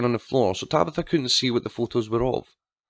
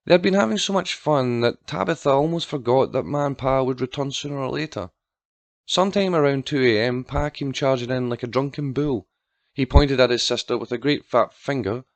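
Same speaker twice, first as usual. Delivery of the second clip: usual speaking voice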